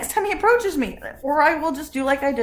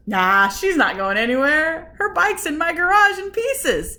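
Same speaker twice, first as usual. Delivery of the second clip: dismissive; 5 semitones higher, 6 dB louder than usual. Retold or imitated. Voicing husband's dismissive reply.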